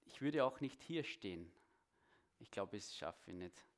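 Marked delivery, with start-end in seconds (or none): 2.4-3.6 softer, sheepish admission; giving up. Real, not acted. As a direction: drop your voice and give up. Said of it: "es ist wirklich schwierig für mich".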